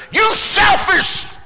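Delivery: high, slow furious yell; shouting insult; angry shout. Retold or imitated. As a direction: bellow it like an outraged man. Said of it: "and he says".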